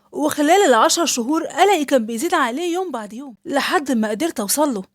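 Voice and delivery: ordinary talk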